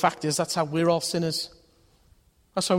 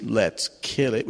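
put-on sinister little girl; 5 semitones lower, slower than usual. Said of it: "Sweet little things".

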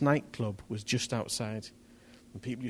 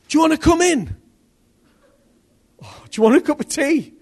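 a high, loud, rushed offer; jittery invitation; 11 dB louder than usual. Retold or imitated. Shaken preacher inviting the stranger in for tea, high and loud. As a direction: blurt out the invite while still shaken.

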